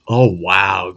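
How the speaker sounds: ordinary delivery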